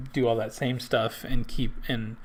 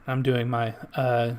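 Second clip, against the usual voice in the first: ordinary delivery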